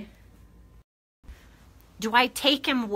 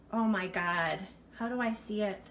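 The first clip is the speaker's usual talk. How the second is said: ordinary delivery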